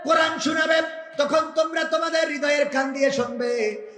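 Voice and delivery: high, loud sermon chant; preaching at full force. Acted. Preacher to the crowd, chanting what the Quran commands. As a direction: call it out to the crowd.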